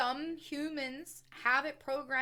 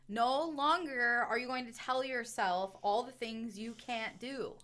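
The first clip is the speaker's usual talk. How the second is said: usual speaking voice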